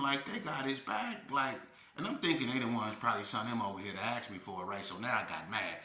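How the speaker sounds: ordinary talk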